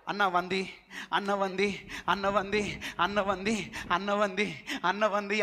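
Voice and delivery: repeated lightly mocking plea; mock begging. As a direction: imitate a man begging his elder brother over and over. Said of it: "అడుక్కుంటేనా?".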